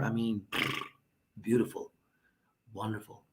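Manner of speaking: ordinary talk, nothing marked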